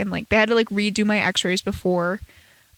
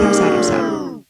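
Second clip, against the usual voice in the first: mock sad; 5 dB louder, slower than usual. Sarcastic mock lament about snow.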